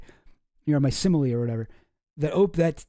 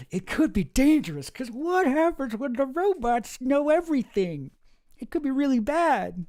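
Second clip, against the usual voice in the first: mocking tech guys; 9 semitones higher than usual. Mocking tech leaders who warn of robots, high mock worry. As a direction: parody their hand-wringing worry in a high voice. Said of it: "They only wanna talk about".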